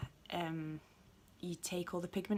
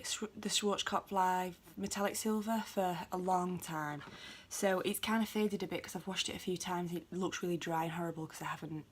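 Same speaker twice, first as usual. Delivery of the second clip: usual speaking voice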